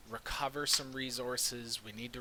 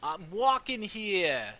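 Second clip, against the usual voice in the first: put-on New York accent; 8 semitones higher, 8 dB louder than usual. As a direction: shout it like a New York cabbie. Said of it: "in a New York accent".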